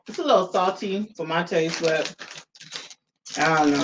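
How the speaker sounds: talking with food in mouth; mid-chew tasting snack. Real, not acted. Seen 2: Speaker ate chips beforehand; lips pursed, jaw working while speaking.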